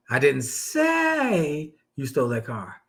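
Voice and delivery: slow down and lean on say; stress on say. Shown as a demonstration. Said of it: "put the emphasis on a different word".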